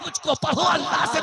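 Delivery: high, forceful preaching; impassioned exhortation. Real, not acted. Exhorts congregation at full force.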